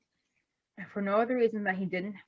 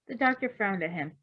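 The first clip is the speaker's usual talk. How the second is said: usual speaking voice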